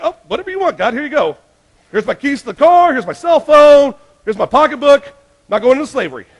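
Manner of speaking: high, hurried eager giving-up; mock frantic surrender. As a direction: hand it all over in a panic.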